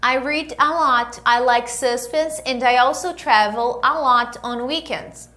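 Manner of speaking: clear, measured English lesson delivery; model dialogue reading. Acted. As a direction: read each line for them to repeat. Reads dialogue lines for students. (leitura de diálogo modelo)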